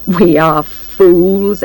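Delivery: very slow, rueful statement; heavy, drawn-out confession; rueful admission. A narrator's reading. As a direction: spell it out slowly, word by word. Man ruefully admits they were fooled.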